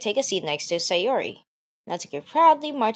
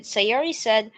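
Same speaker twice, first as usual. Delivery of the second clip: ordinary delivery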